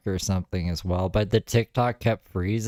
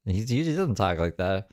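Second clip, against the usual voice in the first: ordinary delivery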